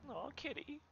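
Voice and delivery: very high, soft coo. Real, not acted. Streamer cooing at the game cat, soft and high. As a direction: coo at the kitty.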